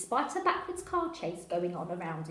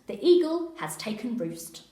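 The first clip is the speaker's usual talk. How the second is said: louder repeat of the radio code; 3 semitones higher, 8 dB louder than usual. Acted. Officer repeating his coded report, louder radio talk. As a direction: say it again, firmer and louder. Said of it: "I repeat".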